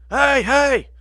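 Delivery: loud, high call through the house; hey hey yelled up high to the wife. Retold or imitated. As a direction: shout for the wife from the shower. Calling out to absent wife.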